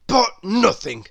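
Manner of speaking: loud, cross grumble; a bad-tempered old grouch barking back; grumpy Mister Grumpy. Grumpy cuts Happy off.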